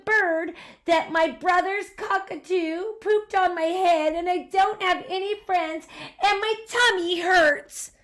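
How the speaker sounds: put-on high, loud tearful wail of a small boy; crying child. Sam crying about his bad day, tearful. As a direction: pour out every woe through tears. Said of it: "the little boy cried".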